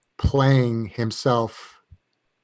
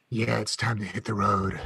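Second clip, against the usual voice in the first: gravelly whisper voice; 2 semitones lower, 6 dB quieter than usual. Pretend. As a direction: rasp it out like a weary biker. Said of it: "having that gravelly whisper voice".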